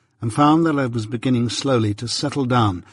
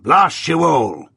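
blasting curse; 5 semitones higher, 3 dB louder, slower than usual. A narrator's reading. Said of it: "he wound up".

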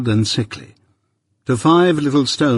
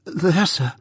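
put-on frightened boy; 6 semitones higher, 3 dB quieter than usual.

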